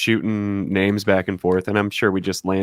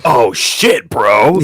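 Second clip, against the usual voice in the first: hyped bro; 2 semitones higher, 8 dB louder, much slower than usual. Retold or imitated. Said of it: "We were like".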